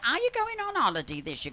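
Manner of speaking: high, fast mimicry of the hairdresser; high quick hairdresser impression; hairdresser's voice. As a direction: imitate her chirpy quick way of talking. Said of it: "'Cause she talks like that".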